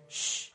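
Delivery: long, soft shush; hushing. Acted. Jesus silencing wind and waves, hushing. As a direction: hush it with one breathy sound. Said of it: "speak to the wind and the waves and say".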